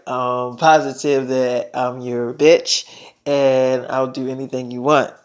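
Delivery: low, slow, soft voice; intimate pledge. Retold or imitated. Selena pledges herself to David, intimate pledge. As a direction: pledge devotion slowly, voice dropped.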